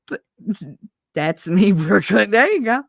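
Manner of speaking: ordinary delivery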